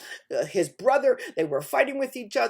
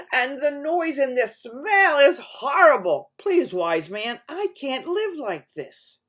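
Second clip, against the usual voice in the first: pleading; 4 semitones higher, 8 dB louder, slower than usual. Acted. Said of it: "and he said, "Wise man".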